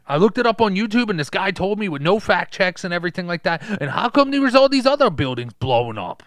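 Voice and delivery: loud, insistent crank explaining his evidence; punchy, worked-up conspiracy rant; conspiracy theorist. Acted. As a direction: rant like a proud New York truther. Plays ranting conspiracy theorist.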